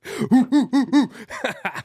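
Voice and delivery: loud, high burst of real laughter; hearty real laugh; big laugh. Real, not acted. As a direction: crack up out loud. Friend cracks up at impression.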